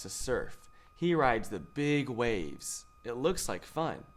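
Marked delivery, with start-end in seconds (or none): none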